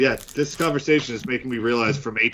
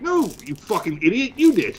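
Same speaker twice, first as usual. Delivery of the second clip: rising to a high yelled denial; indignant retort; 8 semitones higher than usual. Retold or imitated. Retold retort to friend.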